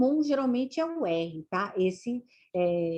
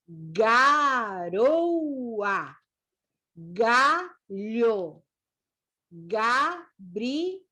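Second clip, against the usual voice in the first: very slow, stretched syllables with full final vowels; syllable-by-syllable reading; much slower than usual. Shown as a demonstration. Teacher reading words with ga, ge, gi to learners, slow and syllabified. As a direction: read each word slowly, part by part.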